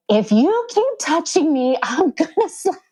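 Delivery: high-pitched mock threat; retold angry yell. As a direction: act out yelling at the doctor, without real volume. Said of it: "And I yelled at her".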